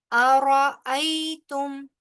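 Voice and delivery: अरअयतुम recited slowly with the hamza crisp and the ra full; slow Quranic chant of अरअयतुम; tajweed recitation. Shown as a demonstration. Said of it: "जो है वो तेजी से अदा होगा".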